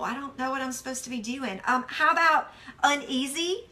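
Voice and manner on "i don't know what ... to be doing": quiet, fast, hopeless mumble. Shown as a demonstration. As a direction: mutter it like you've given up. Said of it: "I wrote, "I feel hopeless".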